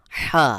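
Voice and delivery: হা with a pressed h from mid-throat. Shown as a demonstration. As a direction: push the h of হা from the middle of the throat. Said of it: "একটু চাপ দিয়ে উচ্চারণ করতে হবে". (হা গলার মধ্যখান থেকে চাপ দিয়ে)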